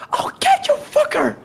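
high, loud acted yell; Claire shouting. Imitating a game heroine yelling at her enemy, high furious shout. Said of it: "Claire shouting out".

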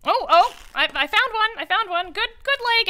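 high, loud squeaky little lizard-dog merchant; kobold voice. Acted. Kobold stallkeeper calling out to customers, high and squeaky. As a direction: yap it out like a tiny eager kobold shopkeeper.

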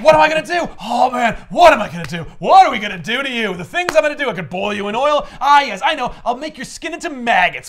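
hammed-up villain gleefully planning torture; high, loud, ranting demon; Mephisto. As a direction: revel loudly in each new threat. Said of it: "Mephisto shows up and he's like".

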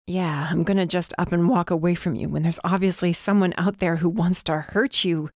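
ordinary delivery

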